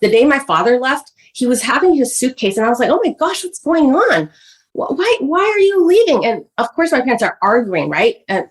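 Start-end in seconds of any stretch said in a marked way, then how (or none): 2.9-6.3 higher-pitched, anxious questioning; acting out a child's bewildered alarm; alarmed child retold. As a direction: imitate a kid pleading for answers. Retells child's alarm as father leaves.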